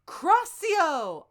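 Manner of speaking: loud, high spell cast; shouted spell. Acted. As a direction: yell the curse out. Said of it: "I shouted".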